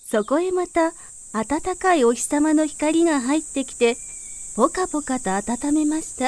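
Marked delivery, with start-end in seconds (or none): none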